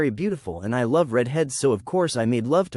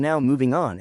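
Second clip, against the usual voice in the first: ordinary delivery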